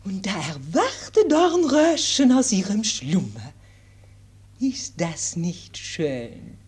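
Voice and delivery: put-on gentle governess with French accent. French governess tells fairy tale.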